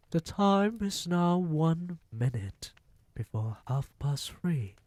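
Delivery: lightly voiced witch announcement; Grand High Witch. A narrator's reading. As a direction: announce the hour like a gloating witch. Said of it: "announced the Grand High Witch".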